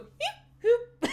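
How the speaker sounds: high little squeaked sword noises; sword sound effect. Retold or imitated. Podcaster imitating the sword on the wee screen, squeaky. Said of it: "the sword just going".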